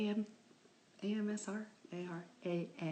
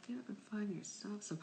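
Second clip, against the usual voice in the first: whisper; 3 semitones higher, 9 dB quieter than usual. Real, not acted. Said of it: "soft aside".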